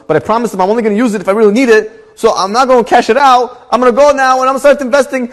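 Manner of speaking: high, loud, excited retelling; all excited. Retold or imitated. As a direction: gush out the plan at a high pitch. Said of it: "and he's all excited".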